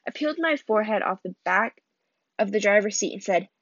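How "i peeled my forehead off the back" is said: ordinary delivery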